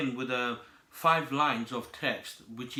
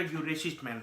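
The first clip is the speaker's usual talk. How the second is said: usual speaking voice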